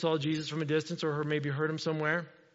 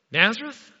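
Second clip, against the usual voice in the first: high, loud, rising question; mock the town name in scornful disbelief; prejudiced disbelief; 5 semitones higher, 6 dB louder than usual. Nathanael sneering at Philip's hometown claim, prejudiced disbelief. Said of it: "a very prejudiced comment".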